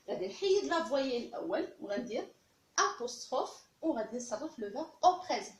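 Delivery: ordinary talk, nothing marked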